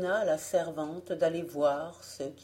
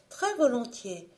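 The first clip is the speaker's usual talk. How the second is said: jeune femme empressée, voix plus aiguë; elle accepte avec zèle; Alice; 6 semitones higher than usual. A narrator's reading. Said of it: "reprit Alice". (Alice répond à son mari, voix aiguë)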